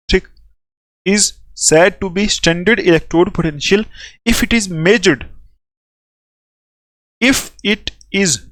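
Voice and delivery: very slow, word-by-word dictation; measured teacher's dictation, repeating phrases; slow classroom dictation. Real, not acted. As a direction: dictate in small chunks with long pauses for note-takers. Dictates a definition for note-taking.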